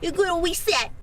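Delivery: high, cutesy mimicry; cutesy baby talk. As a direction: echo it back baby-like. Echoing in a cute voice.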